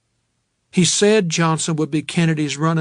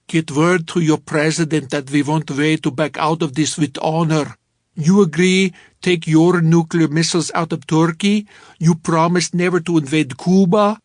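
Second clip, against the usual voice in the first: put-on a pretty good, louder Russian diplomat accent; 5 dB louder than usual. Narrator imitating the Russian diplomat for Sadie, in a Russian accent. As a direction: lay on a heavy Russian accent, firm and demanding. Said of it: "here I did a pretty good Russian accent".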